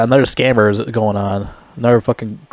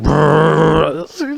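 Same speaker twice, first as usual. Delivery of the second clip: machine gun noise; 5 semitones higher than usual. Retold or imitated. Imitating machine gun fire.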